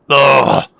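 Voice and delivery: loud hiccup with a groan; sharp real hiccup, then ugh. Real, not acted. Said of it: "[name]'s got the hiccups".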